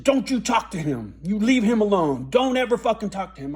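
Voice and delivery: loud, sharp warning from an alarmed mother; angry mother. Retold or imitated. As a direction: forbid it hard, like a furious parent. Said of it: "she got so fucking angry".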